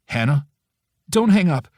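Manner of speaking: desperate plea. A narrator's reading. Friend pleading with the angry narrator not to hang up, desperate. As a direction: beg her not to hang up, fast and high. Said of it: "she says quickly, her voice desperate".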